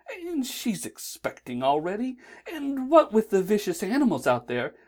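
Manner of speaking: a polite, fussy angel explaining; lightly put-on angel voice. A narrator's reading. Aziraphale voiced in the story.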